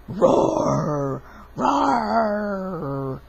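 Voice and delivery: drawn-out pretend dinosaur roar. Child playing dinosaur, long mock roar. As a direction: roar like a make-believe dinosaur.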